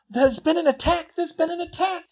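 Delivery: high, loud alarmed cry; yelling. A narrator's reading. Katie tumbling into the common room, yelling. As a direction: raise the alarm at the top of the voice.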